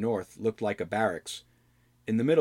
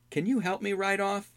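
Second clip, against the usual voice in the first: put-on pleading young hero; 11 semitones higher than usual. Albrecht asks.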